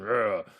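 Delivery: short, loud growled roar; big lion roar. Shown as a demonstration. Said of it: "So the lion's voice is big and".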